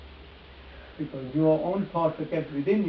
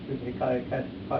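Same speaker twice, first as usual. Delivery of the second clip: ordinary talk, nothing marked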